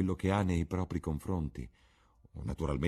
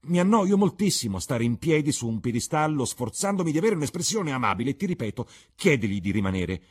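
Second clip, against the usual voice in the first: put-on louder, higher, a spoiled young man insisting; petulant exclamation; 7 semitones higher, 8 dB louder than usual. A narrator's reading. Dorian pleads with Basil to keep Henry, loud petulant. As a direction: whine it out to get your way. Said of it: "esclamò Dorian Gray".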